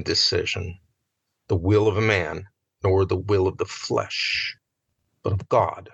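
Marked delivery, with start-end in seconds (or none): none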